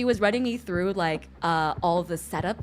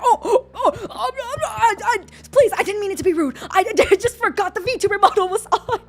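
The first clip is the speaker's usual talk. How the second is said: high, loud, laughing panic; flustered apology; 11 semitones higher, 7 dB louder than usual. Retold or imitated. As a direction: scramble to apologize, caught out. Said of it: "and I was like".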